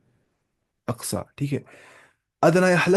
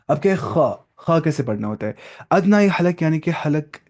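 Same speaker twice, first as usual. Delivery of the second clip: ordinary delivery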